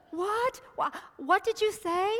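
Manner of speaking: high, loud, stunned question; shocked disbelief. Acted. Man told to let go of the branch, shocked high disbelief. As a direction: balk at what you just heard.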